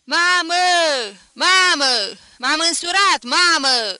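high, very loud yelling for mother; howling shout. A narrator's reading. Son announcing his wedding to mother, howling it out. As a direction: bellow the news, wild and high. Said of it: "Îl auzi pe Vlășgan urlând".

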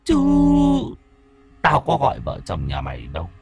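the spirit coolly taunting the grandfather in a ghostly whisper; slow unhurried taunt. Acted. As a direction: breathe it out like a voice from the underworld. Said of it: "thủng thẳng".

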